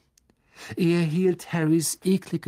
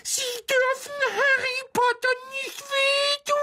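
very high squeaky protest; piercing creature squeal of warning; house-elf cry. A narrator's reading. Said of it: "Doch Dobby rief".

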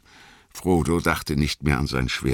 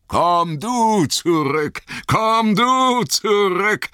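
high, slow, louder chant with stress on du; icy mocking call; 4 dB louder, slower than usual. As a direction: taunt him back, slowly. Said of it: "riefen sie".

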